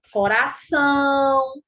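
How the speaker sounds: "coração" slowly, ÇÃO held long and level; final syllable drawn out. Shown as a demonstration. Teacher giving examples of oxítonas, "coração" with ÇÃO stretched. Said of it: "Sílaba tônica é a última".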